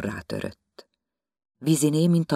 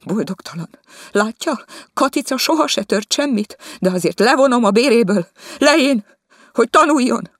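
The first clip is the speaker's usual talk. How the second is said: high, loud scolding tirade; 11 semitones higher, 8 dB louder than usual. Acted. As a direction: berate her from behind while she sweeps. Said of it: "ő a háta mögött pirongatta". (hangos, magas szidás)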